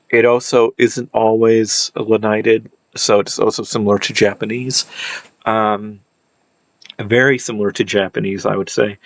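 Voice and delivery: ordinary delivery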